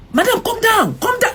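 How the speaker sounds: high mock shout; retold officer yelling. Officer yelling at a driver, high mock shout. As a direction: bark it like an armed officer. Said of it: "They started yelling".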